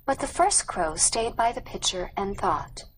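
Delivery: usual speaking voice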